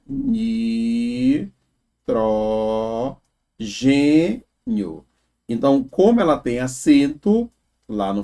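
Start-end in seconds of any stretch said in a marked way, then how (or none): 0.1-5.0 Nitrogênio stretched out syllable by syllable, ni-tro-gê-nio; very slow syllable split. Shown as a demonstration. Teacher showing students how the word divides, very slow. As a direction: sound out Nitrogênio very slowly. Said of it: "como é que fica".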